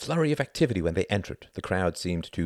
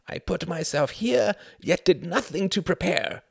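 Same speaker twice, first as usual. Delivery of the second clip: self-reproach; 9 semitones higher than usual. A narrator's reading. Klaus silently cursing himself at the aerodrome, anguished self-reproach.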